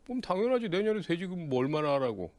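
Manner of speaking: high-pitched, cocksure claim; acted bravado, pitched well up; brash boasting. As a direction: blurt it out as a bold brag. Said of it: "아니면 지르는 거야".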